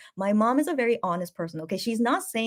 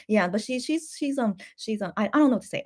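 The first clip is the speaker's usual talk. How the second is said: normal talking voice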